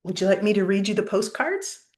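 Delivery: ordinary delivery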